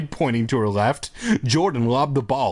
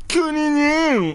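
very high, drawn-out mocking copy; stretched squeaky imitation; annoying mimicry; 14 semitones higher, slower than usual. A narrator's reading. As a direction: parrot it in a slow, garbled squeal. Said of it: "repeating things that people say in an annoying tone".

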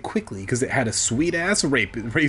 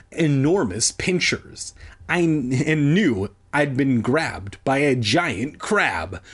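plain reading voice

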